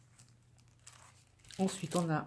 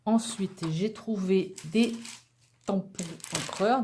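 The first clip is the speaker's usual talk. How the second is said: ordinary talk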